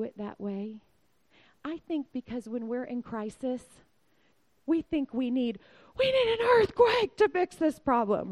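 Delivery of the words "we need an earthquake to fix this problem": much higher, louder, frantic begging; desperate plea. Pretend. A believer in crisis begging God, loud and frantic. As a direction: beg God for a miracle, voice straining high.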